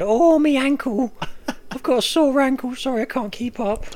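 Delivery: mock pathetic injured whine; mock whiny invalid. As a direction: ham up a feeble wounded moaner. Mocking friend's limping excuse.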